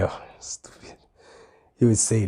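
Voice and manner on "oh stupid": whispered. Retold or imitated.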